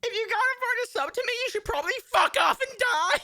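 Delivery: very high, loud squeaky demand; high shrill mock snarl ending in a shout; mock bratty streamer. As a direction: screech it like a spoiled streamer. Mocking entitled streamer demand.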